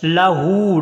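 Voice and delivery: लहू with a loud, long-held oo; lengthened haa. Shown as a demonstration. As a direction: stretch the hoo of लहू. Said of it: "हा को लंबा करना". (लहू में हू को लंबा खींचकर)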